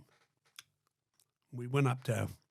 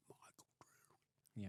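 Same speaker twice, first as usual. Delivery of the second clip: slow, breathless whispered name; 5 semitones lower, 23 dB quieter, much slower than usual. Retold or imitated. Confronting Rod on the plane, slow whisper. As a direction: drop to a secret hush on the name. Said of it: "that's how I did it. I went".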